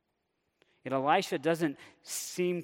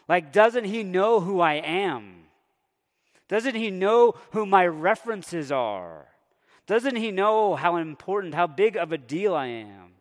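indignant Naaman; 4 semitones higher, 6 dB louder than usual. Retold or imitated. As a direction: play his bruised ego, drawing out each question. Preacher voicing Naaman's wounded pride.

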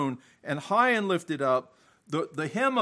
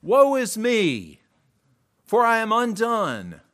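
raised, higher retold cry of woe; anguished cry; 4 semitones higher, 5 dB louder than usual. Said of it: "causes Isaiah to cry".

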